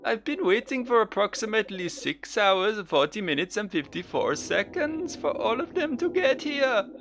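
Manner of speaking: put-on high, fussy old man voice; impatient old deacon. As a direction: complain like a huffy elder. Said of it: "I'm going for Welsh here".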